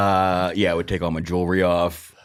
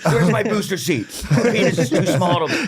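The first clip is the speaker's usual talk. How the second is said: high, slow, laughing whine; mock a tiny man's whine; mocking whiny voice; 10 semitones higher, 3 dB louder than usual. Mocking a petty road-rager.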